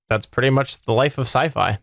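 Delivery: normal talking voice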